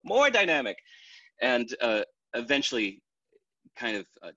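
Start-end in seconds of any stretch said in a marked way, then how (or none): 0.0-0.8 boss again. Retold or imitated. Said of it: "It's like".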